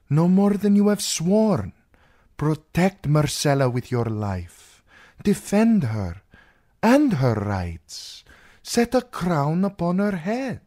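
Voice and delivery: emphatic, swinging pitch as the princess; urgent princess. Acted. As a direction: urge the knight with commanding force. Princess urges the knight in dialogue.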